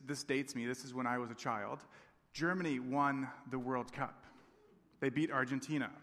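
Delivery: ordinary talk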